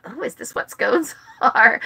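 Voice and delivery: laughing mock surprise; puzzled, half-laughing aside; amused disbelief. Remembering dry wedding scones, amused mock puzzlement. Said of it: "and I thought".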